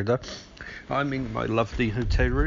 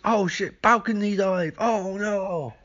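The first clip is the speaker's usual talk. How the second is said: mock alarm; 11 semitones higher, slower than usual. Retold fall, mock panic.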